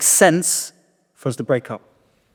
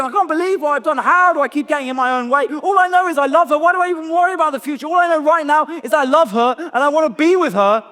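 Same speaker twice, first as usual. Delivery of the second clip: raging; 13 semitones higher than usual. Retold or imitated. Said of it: "I'm just raging".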